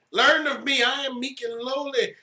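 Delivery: Learn of me rising high and loud; preaching emphasis. Shown as a demonstration. Preacher calling the church to learn of Jesus, loud. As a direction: call it out to the congregation. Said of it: "repeated louder, preaching force".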